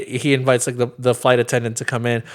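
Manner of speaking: usual speaking voice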